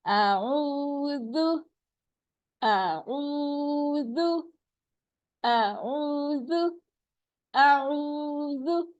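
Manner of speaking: slow, stretched recitation; measured tajweed chant; Quranic recitation. Shown as a demonstration. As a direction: recite slowly with long vowels. Recitation drill.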